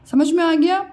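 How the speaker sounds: normal talking voice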